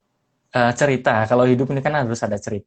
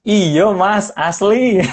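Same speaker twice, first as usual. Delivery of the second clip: laughing; 10 semitones higher, 5 dB louder, much slower than usual. Real, not acted. Laughing confirmation of hometown.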